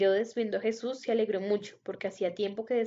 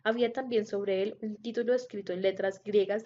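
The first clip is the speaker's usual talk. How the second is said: usual speaking voice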